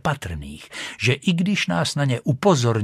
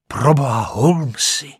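raised cry of admiration; admiring exclamation; 3 semitones higher, 5 dB louder than usual. Acted. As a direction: exclaim it, impressed by a friend. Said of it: "zvolal jsem s obdivem".

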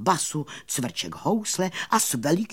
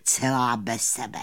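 plain reading voice

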